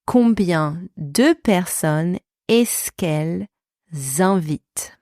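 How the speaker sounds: slowed down for learners; slow careful repeat. Shown as a demonstration. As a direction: spell it out slowly, phrase by phrase. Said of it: "Est-ce que method".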